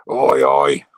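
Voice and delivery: loud, low "oi oi" to a new viewer; shouted greeting to a viewer arriving late; loud call out. Real, not acted. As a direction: hail a mate across the room. Greeting viewer Lenny joining.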